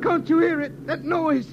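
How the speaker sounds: alarmed. Acted. Lemmy hears the strange noise.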